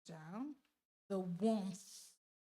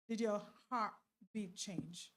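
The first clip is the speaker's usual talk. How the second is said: ordinary talk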